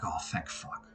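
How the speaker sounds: breathed-out whisper of relief; relieved whisper. Retold or imitated. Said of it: "I think Semi was like".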